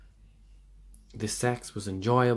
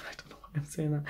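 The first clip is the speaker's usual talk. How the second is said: quick, higher, laughing aside; 6 semitones higher, 7 dB quieter, much faster than usual. Real, not acted. Tarot reader laughing at her own words to viewers. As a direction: laugh it off, half embarrassed.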